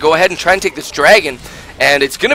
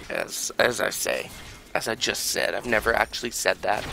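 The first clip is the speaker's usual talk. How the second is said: quiet aside; 5 semitones lower, 13 dB quieter than usual. Real, not acted. Muttered self-mocking aside.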